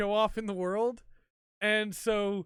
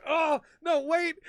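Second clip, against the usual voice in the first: alarmed protest; 9 semitones higher, 7 dB louder, slower than usual. Retold or imitated. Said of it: "he goes".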